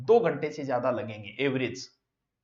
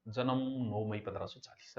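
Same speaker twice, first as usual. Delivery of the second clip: softer, lower murmured recitation to oneself; quietly reciting to memorise; rote recitation; 6 semitones lower, 9 dB quieter than usual. Shown as a demonstration. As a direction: mumble the date over as if memorising it. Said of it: "जन्म याद करो".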